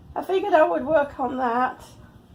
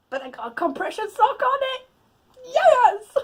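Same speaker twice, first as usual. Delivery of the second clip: high, loud cry of victory; triumphant; 11 semitones higher, 4 dB louder than usual. Real, not acted. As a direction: crow it like a win. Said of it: "triumphant after struggle".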